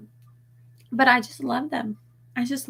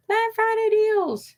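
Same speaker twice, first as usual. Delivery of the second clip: high mock voice; 13 semitones higher, slower than usual. Mock excited waiting.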